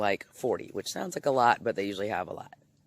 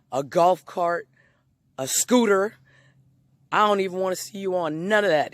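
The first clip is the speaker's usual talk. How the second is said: starts firm, climbs higher and louder with each vehicle; judge building to a shout; 4 semitones higher, 5 dB louder than usual. Retold or imitated. Judge warning the old man off anything motorized, building to a shout.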